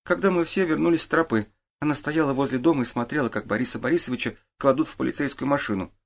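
lightly voiced boy giving his account; boy witness. A narrator's reading. Boy tells the detective what he did, earnest.

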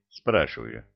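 ordinary delivery, nothing marked

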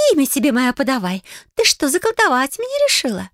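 high, suspicious hissing mermaid. Acted. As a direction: snap at her like a cornered creature. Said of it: "Зашипела и оскалилась нечисть".

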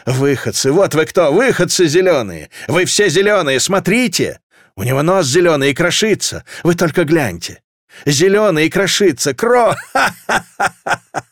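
high hysterical yelling that breaks into crazed laughter; madman's shrieking rant ending in a laughing fit; screams turning into laughter. A narrator's reading. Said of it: "Вопли переросли в хохот".

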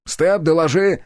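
shouting. A narrator's reading. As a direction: call out the order. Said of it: "прокричал я". (крик)

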